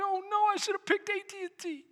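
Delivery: high-pitched, hurried comic fretting; mock panic. Preacher voices the chased woman for a laugh, mock panic. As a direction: fret in a high, rushed woman's voice.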